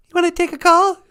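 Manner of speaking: very high loud sugary voice; high mock cohost voice; sweet cohost voice. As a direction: chirp it like a bubbly cohost.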